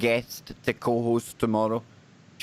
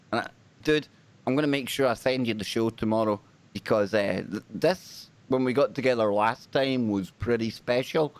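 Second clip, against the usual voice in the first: normal talking voice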